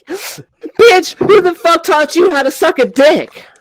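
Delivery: high-pitched mock angry yell; retold yelling. Boyfriend accuses girlfriend over what she learned, mock yelling. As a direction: imitate an outraged boyfriend yelling. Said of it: "he just started yelling at me".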